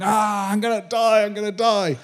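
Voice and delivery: high, frantic mock cry of dying; high retold panic yell; panicked shout. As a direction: yell it like you're doomed.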